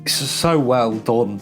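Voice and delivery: ordinary talk, nothing marked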